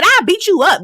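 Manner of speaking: high childish mock threat; mocking Alexis. Mocking Alexis's threat in a high voice. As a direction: parrot it like a playground kid. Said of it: "she said".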